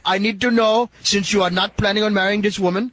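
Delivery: a light, hammed-up Indian accent; put-on Indian accent. Prank caller quizzing the Irishman, in a light mock Indian accent. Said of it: "stupid of an accent".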